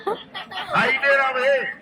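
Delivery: slow, high, stretched call across the water; calling from far away. Real, not acted. Calling to people on shore.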